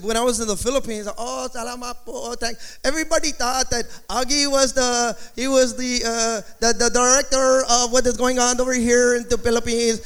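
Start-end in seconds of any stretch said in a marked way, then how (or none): 1.2-10.0 put-on Filipino accent with level pitch; mock Filipino-accented English. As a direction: slip into an imitated Filipino accent. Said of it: "my brain automatically does it".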